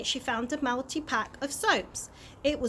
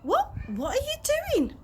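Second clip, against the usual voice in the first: shocked; 3 dB louder than usual. Acted. Dad shocked at pond bath.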